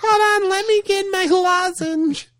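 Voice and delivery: mock him in a tiny squeak; squeaky mocking voice. Teasing friend in squeaky voice.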